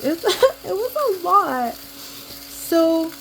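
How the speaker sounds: laughing it out, high; a real laugh breaking through the words; laughing that it was too much. Real, not acted. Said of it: "it was a lot, guys".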